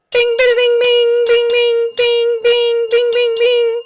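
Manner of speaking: high, loud sung bing-bings; bell imitation. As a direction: ring it out like a bell. Ringing the bell again.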